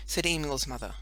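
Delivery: ordinary delivery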